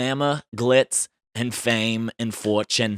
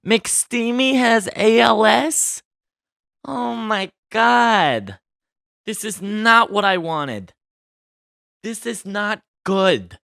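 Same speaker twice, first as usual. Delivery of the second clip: shocked dismay; 9 semitones higher, 3 dB louder, slower than usual. Real, not acted. Reacting to Eric Dane's ALS.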